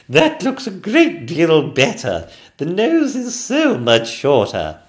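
high, loud, slow regal voice; haughty queen. Acted. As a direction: approve grandly. Queen approving.

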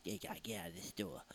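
whispered, soft mock order; a barely voiced warning to leave; muttered guard. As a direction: mumble it like a tiny guard. Security guard quote, whispery.

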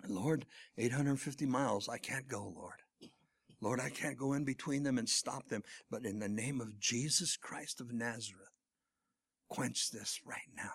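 soft, low retold plea to the Lord; earnest prayer. Son praying for family far away, quiet earnest prayer. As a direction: pray it quietly and intently. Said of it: "And that is prayer".

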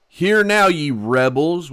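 loud, high furious call-out; angry rebuke. Retold or imitated. Moses shouts at the rebellious people, angry. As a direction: hurl it at the crowd in anger.

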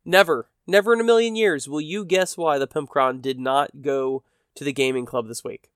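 ordinary talk

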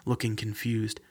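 ordinary delivery

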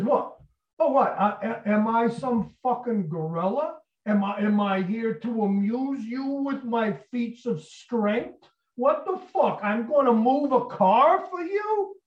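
acting out a building, aggrieved rant; sarcastic indignation. Tony balking at his friend's car request, high sarcastic outrage.

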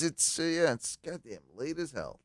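slurring, tipsy and rambling. Real, not acted. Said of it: "I'm pretty drunk".

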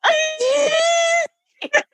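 high wailing fake cry. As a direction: stretch it into a mock sob. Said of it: "fake cry like this".

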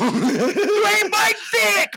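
high-pitched, drawn-out yell; heckling shout. Real, not acted. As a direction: holler it at a buddy in mock outrage. Mock quarrel over passing.

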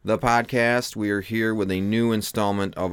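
bright opening roll call, then brisk; quick, upbeat podcast welcome; host welcome. Acted. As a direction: greet the audience like a show host.

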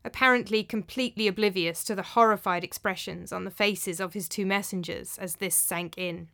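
usual speaking voice

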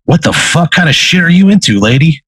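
high-pitched, baffled questioning; incredulous demon. Acted. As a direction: pitch it up in acted disbelief. Demon baffled by the occult items.

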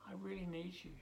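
a touch of an urgent plea; mild earnest plea; pleading prayer. Pretend. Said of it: "I hope you speak like that".

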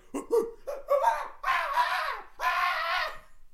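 high, loud monkey hooting and shrieking; monkey screeches. Retold or imitated. Him laughing at stranded friends, wild monkey shrieks. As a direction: imitate a frenzied monkey's whooping laugh. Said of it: "jumping like a fucking monkey".

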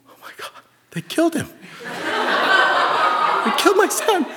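high, strained, hammed-up horror; mock panic. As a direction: squeak out comic parental despair. Father panicking over unconscious son, acted.

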